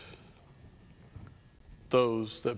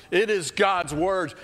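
"It is God's words" higher and louder, slow; louder repeat; 6 semitones higher, 4 dB louder, slower than usual. Shown as a demonstration. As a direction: build it up, proclaim it.

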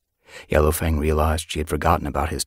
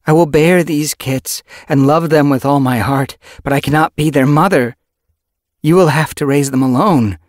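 high, loud voiced character; cat character voice; 10 semitones higher, 9 dB louder than usual. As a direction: play the she-cat higher. Yellow Fang explaining to mate.

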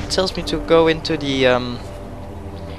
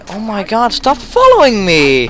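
loud, high, exasperated shout at pursuers; annoyed shout; frustrated yell; 7 semitones higher, 6 dB louder than usual. Real, not acted. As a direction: yell at them to back off. Chased by enemies, yelling.

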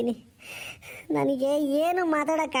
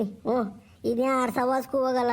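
usual speaking voice

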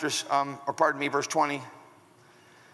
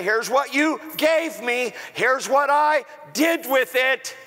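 fired up; 6 semitones higher, slower than usual. Acted. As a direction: announce it like a triumph. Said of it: "he's been pretty fired up to say".

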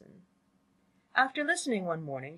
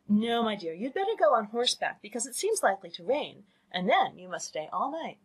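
higher, brisk motherly instruction; scheming mother; 5 semitones higher, 3 dB louder than usual. Acted. Mother sending her daughter out on horseback, managing and animated. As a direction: advise firmly as a managing mother.

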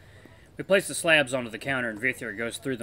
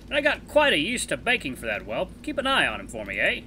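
put-on higher, genial older wolf voice; friendly older wolf; 6 semitones higher, 6 dB louder than usual. Elder wolf offering to take the pup as apprentice, genial and higher. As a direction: play the kindly elder wolf teasing his friend. Voices Vithir in visual novel.

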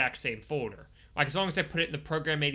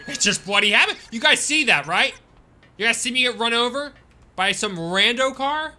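higher, louder indignant complaint; frustrated rant; 8 semitones higher, 8 dB louder than usual. Real, not acted. Streamer to chat after getting run over, indignant. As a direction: appeal to chat in disbelief.

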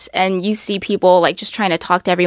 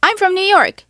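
bright, much higher "I'm from New York"; more interesting; 11 semitones higher than usual. Shown as a demonstration. Vlogger showing how to answer where you're from, lively. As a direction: sell "New York" with a lift. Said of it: "Make it more interesting".

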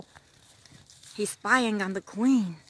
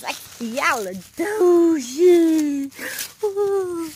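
silly sing-song; 5 semitones higher, 9 dB louder, much slower than usual. Pretend. Silly drawn-out word.

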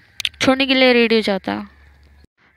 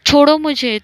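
sharp protest; 5 semitones higher, 5 dB louder than usual. Retold or imitated. Manu pulls her hand free.

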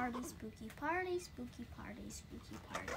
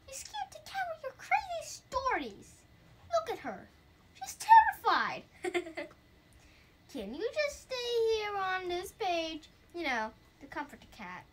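higher, teasing ghost who won't budge; 7 semitones higher, 5 dB louder than usual. Acted. Ghost scolding the reader for scaring the cat, higher teasing voice. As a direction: play the stubborn ghost, higher and cheeky.

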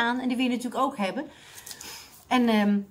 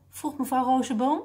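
ordinary delivery

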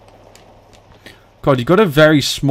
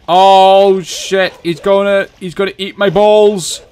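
high, loud panicked cry; rising panic; 9 semitones higher, 7 dB louder, slower than usual. Real, not acted. Player sees it coming for him, panicked. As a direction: cry out in alarm as danger closes in.

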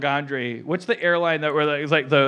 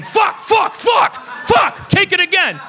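much higher, loud shouted curses; mock blooper outburst; 8 dB louder, slower than usual. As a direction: blow the take and yell at yourself. Mock pilot botching video take.

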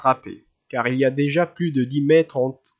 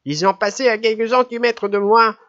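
put-on indignant old lady; 9 semitones higher, 5 dB louder than usual. A narrator's reading.

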